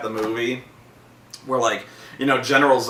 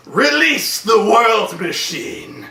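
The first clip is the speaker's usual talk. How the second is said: slow, higher grand command; mock villain decree; 8 semitones higher, 3 dB louder, much slower than usual. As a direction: intone it like a pompous god. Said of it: "so he goes".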